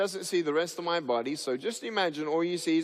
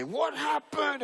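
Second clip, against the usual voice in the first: high, slow wide-eyed question; drawn-out childlike astonishment; imitated shocked kid; 10 semitones higher, slower than usual. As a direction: pipe it up like a startled child. Kids' blunt question acted out.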